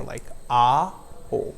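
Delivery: ah then oh, two separate movements; split diphthong. Shown as a demonstration. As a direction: show both halves of ow. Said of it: "see how my mouth is opening twice".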